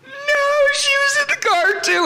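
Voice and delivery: very high hammed-up wail; fake crying. Boy lying to caller about mom, mock bawling. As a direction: squeal it out in mock tears. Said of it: "and I'm crying".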